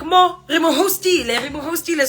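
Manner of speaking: high, lively uncle voices; uncles voiced. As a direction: voice the uncles cheerfully. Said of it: "They're like".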